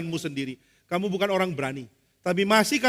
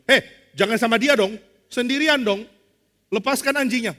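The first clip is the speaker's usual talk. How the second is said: imitated alarm, high and raised; alarmed protest; 5 semitones higher, 6 dB louder than usual. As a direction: protest hastily at the sight of a dog. Friend alarmed by big dog. (protes panik yang ditirukan)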